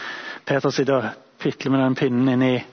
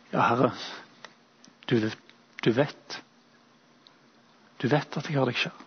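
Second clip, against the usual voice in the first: halting, broken into fragments with long pauses, softer at the end; stammering; 4 dB quieter than usual. Acted. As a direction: stumble the words out, stopping between each piece. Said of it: "stotrer han fram disse ordene". (stotrende, nølende svar, dempet mot slutten)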